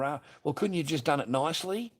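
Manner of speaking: high, loud exasperated question; angry yell. Retold or imitated. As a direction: demand it, exasperated. Retelling yelling at ex.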